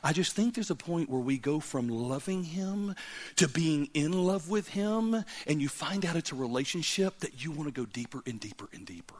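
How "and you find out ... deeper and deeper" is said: soft whisper pulling the listeners in. Real, not acted. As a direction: whisper it like a secret. Intimate sermon point.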